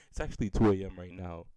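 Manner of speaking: loud, forgetting the late hour; too loud. Real, not acted. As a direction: blurt it out at full volume. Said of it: "I'm sorry".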